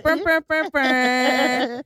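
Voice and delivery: loud, high, trilled brr-brr; mimicked radio brr. Host showing how they copy the radio sound, exuberant brr imitation. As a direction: belt out the radio brr, last one held long. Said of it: "and I'm just like".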